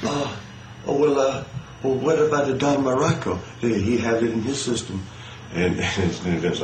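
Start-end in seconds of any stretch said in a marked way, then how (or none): 0.0-5.0 higher-pitched mock Sheik, innocently questioning; Iron Sheik. Sheik asking Vince about another wrestler, higher mock voice. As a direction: imitate the Sheik angling to share the blame. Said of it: "She's like".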